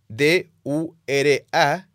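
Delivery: slow letter-by-letter spelling of D U R A; spelled out. Shown as a demonstration. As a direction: spell each letter out slowly for the listener. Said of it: "¿cómo se deletrea?".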